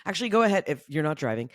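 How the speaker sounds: ordinary talk, nothing marked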